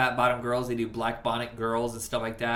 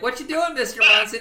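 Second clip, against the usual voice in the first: much higher, louder put-on voice; mock character call; 10 semitones higher, 8 dB louder than usual. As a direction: call out in a high silly voice. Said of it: "he's like".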